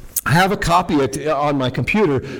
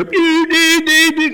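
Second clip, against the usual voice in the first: high squeaky beep beep beep; mock horn beeps; 11 semitones higher, 4 dB louder, slower than usual. Teller imitates the Ferrari's horn with high beeps.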